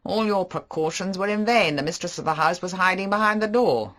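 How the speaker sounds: flirtatious mocking banter; Favell. Favell voiced.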